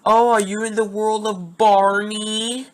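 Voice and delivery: high, loud, slow taunting teacher voice; a student retelling his teacher's taunt, drawn out high and loud; mocking teacher. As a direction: sneer it like a mean teacher mocking a daydreaming kid. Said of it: "she always kept saying".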